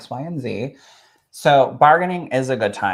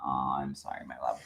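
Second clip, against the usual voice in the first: much lower and far softer, tender; tender sympathy; 11 semitones lower, 12 dB quieter than usual. Real, not acted. Host comforting the grieving guest, low and tender. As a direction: soothe her gently, nearly under the breath.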